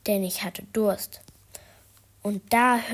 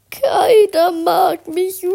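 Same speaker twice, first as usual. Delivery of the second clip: very high, loud, slow sobbing wail; wailing cry; 10 semitones higher, 13 dB louder, slower than usual. Acted. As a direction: bawl it like a heartbroken child. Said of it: "schrie der Schatten".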